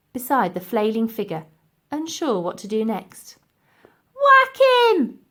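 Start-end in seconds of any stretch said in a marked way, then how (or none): 4.1-5.2 high, loud barked order; a sharp, loud command. A narrator's reading. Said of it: "ordered Dotty".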